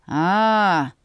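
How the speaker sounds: one long drawn-out "ah". A narrator's reading. Brother taking in his sister's plan, drawn out. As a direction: stretch the vowel out slowly. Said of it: "протянул Ульви".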